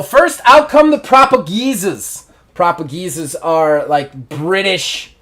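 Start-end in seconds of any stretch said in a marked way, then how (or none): 0.0-2.2 high, loud intro; entrance call. Acted. Announcer calls out the tag team's entrance.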